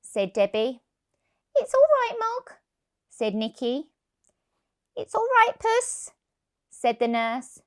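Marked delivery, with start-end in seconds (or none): 1.6-2.6 put-on child's voice. Child comforts cat. 4.9-6.1 higher soothing nurse; calm the cat gently; nurse's voice. Acted.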